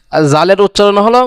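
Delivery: usual speaking voice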